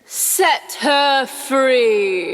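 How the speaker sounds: very slow, commanding pirate voice; Hook impression. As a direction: order it like the captain himself. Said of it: "Wendy, watch this".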